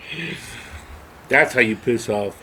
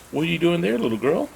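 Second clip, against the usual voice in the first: higher-pitched friendly neighbor quote; nosy neighbor; 7 semitones higher than usual. Retold or imitated. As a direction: play a neighbor calling over the fence. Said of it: "the neighbor looks over the fence".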